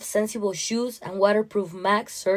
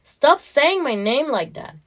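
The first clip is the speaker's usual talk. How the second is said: sharp protest; 6 semitones higher, 4 dB louder than usual. Acted. Wife refusing husband's coaxing.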